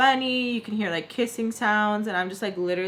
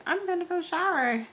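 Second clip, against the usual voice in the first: higher-pitched imitation of being scared to speak up; a mock-timid, raised voice; retold timid announcement; 9 semitones higher than usual. Scared girl excusing herself to an angry woman, timid. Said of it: "I was scared to be like".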